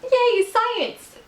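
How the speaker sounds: high-pitched little cheer, falling at the end; hammed-up squeal of delight; mock cheer. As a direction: cheer like a giddy science fan. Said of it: "I was hoping for, like".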